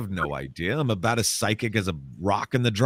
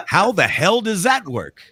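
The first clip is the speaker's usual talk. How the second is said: higher, baffled exclamation; real disbelief, voice jumping higher; incredulous; 10 semitones higher, 4 dB louder than usual. Real, not acted. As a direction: throw up your hands in disbelief, pitched up. Baffled by the ghost's answer.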